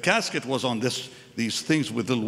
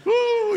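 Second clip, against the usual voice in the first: high, loud drawn-out "woo"; mock wail; 8 dB louder than usual. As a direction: imitate mourners wailing on one high note. Imitates the mourners' wailing.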